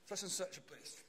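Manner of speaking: put-on English accent, lost driver asking the way. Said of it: "in this English accent".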